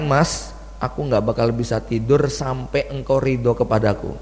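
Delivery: soft, humble wife pleading with her husband; tender plea. Retold or imitated. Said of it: "dan mengatakan". (istri memohon lembut kepada suami, pelan dan lirih)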